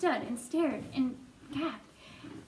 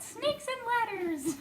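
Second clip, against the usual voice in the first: laughing repeat; 8 semitones higher than usual. Real, not acted. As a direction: savour the phrase and laugh. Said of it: "I like that".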